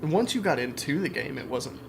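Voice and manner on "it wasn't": ordinary delivery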